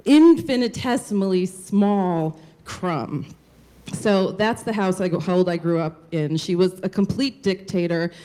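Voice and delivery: ordinary talk, nothing marked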